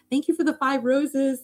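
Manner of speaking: light up and thank them; sudden cheery high thanks breaking off the story; bright thanks. Real, not acted. Livestream viewer sent roses.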